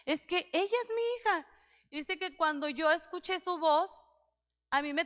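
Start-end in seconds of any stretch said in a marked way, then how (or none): 0.1-1.5 higher, pleading insistence; insistent father. Retold or imitated. Father insisting to the doubting nurse, higher pitched. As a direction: press the point, pitch lifted.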